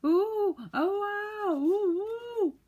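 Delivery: very high, very loud cries of pain; yelling ooh and ow. A narrator's reading. Saucepan man out of sight, yelling in pain, very high and loud. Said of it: "he began to yell".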